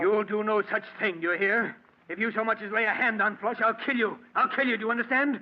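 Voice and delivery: high, loud furious threat. Acted. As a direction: threaten her, rising fury. Threatening wife over dog.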